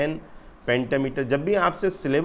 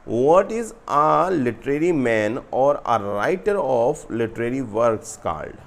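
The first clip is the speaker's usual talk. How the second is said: slow, emphatic question read-out, louder at the start; 4 dB louder, much slower than usual. Real, not acted. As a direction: read each English phrase slowly and firmly. Reads exam question aloud.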